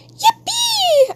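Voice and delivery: very high squealed cheer; tiny high-pitched hooray; playful cheer. Pretend. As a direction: squeal it with mock glee. Cheering after offer of free work.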